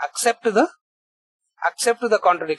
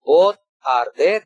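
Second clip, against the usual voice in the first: repeated slowly and a bit louder, stress on both; 2 semitones lower, 4 dB louder, slower than usual. Real, not acted. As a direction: land it again, slowly.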